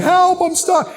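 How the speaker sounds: imitating a stuck man's wail; mock cry for help. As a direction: call out like you're trapped, hammed-up. Imitating trapped man calling for help.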